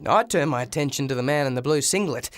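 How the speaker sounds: ordinary delivery, nothing marked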